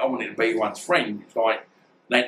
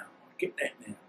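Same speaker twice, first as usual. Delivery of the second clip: "I get that now" repeated almost under the breath, much softer; hushed repeat; 13 dB quieter than usual. Real, not acted. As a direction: let "I get that now" sink into a near-whispered echo, much softer. Repeats phrase, second time hushed.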